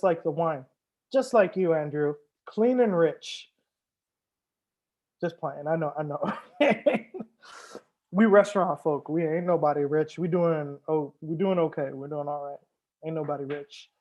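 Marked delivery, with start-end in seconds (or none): none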